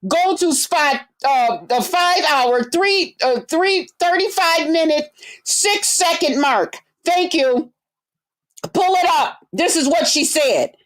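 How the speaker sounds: high, mocking voice of nosy fans; sarcastic impression of chat asking to pull up a video; mocking nosy viewers. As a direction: parody the viewers who demand the exact timestamp. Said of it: "people gotta go".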